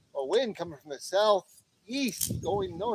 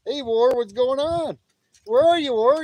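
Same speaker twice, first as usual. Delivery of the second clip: loud greeting; 7 semitones higher, 8 dB louder than usual. Real, not acted. Greeting chat viewer by name.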